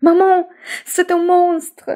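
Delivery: high, loud, slow frightened shout; terrified cry. Acted. Little rabbit fleeing the owl, scared shout. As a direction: cry out in panic to mum. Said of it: "en hurlant".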